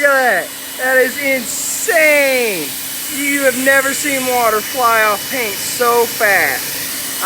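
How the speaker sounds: blown-away yell; amazed. Real, not acted. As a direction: shout in disbelief at the result. Reveal of water beading off paint.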